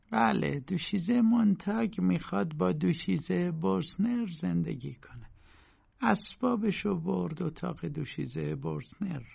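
high, slow landlady voice; landlady character. A narrator's reading. Landlady telling K about the lodger moving rooms, high slow character voice.

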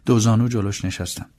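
ordinary delivery

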